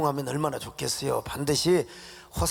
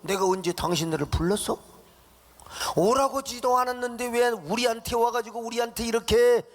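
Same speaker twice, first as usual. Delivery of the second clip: rising, sung-out grumbling that climbs high at the end; 모세를 원망하는 백성의 불평; complaining Israelites; 6 semitones higher than usual. Acted. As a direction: whine and blame Moses. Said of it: "모세에게 원망을 하는 거요".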